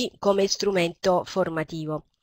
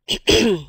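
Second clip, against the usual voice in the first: throat clearing; 2 semitones higher than usual. Real, not acted. Sick lecturer between sentences.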